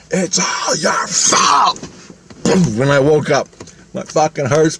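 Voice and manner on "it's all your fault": high, slow, drawn-out yell of blame; a woman's panicked yell of blame, acted out; retold yell. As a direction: shout the accusation right at him. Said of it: "and she goes".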